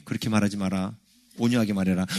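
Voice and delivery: low, softened voice of God; God's gentle correction. Retold or imitated. God tells an angry believer to speak meekly, low and gentle. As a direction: correct them quietly, pitched well down.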